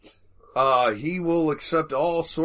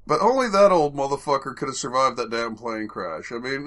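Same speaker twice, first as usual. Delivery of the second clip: ordinary talk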